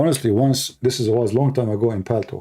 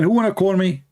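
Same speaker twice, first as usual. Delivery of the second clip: calling out; 7 semitones higher than usual. Retold or imitated. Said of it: "I lost my voice saying".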